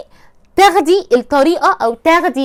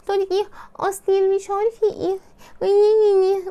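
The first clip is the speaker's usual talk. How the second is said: put-on coy girl voice; 6 semitones higher, 3 dB quieter than usual. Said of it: "وتقعد بقى تتكلم كده".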